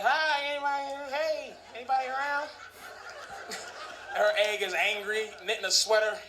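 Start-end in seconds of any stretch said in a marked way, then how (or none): 0.0-2.5 lost sperm calling. Pretend. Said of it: "my sperm's like".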